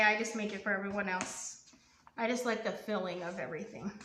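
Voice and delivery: usual speaking voice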